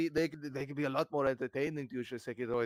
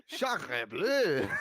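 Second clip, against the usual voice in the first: put-on French accent; 6 dB louder than usual. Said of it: "Oh, are you French?".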